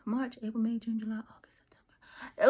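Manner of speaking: whispering the months under the breath; quiet whispered count of wasted months; whispered list. Acted. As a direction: hush it like a guilty tally.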